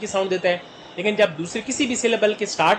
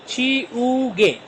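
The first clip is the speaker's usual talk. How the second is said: "ची, उ, गे" long, slow and higher; syllable by syllable; 4 semitones higher, much slower than usual. Shown as a demonstration. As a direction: draw out "ची उ गे" as three syllables. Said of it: "तीन syllable से मिलकर". ("ची उ गे" को धीरे, तीन हिस्सों में)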